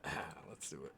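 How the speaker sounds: normal talking voice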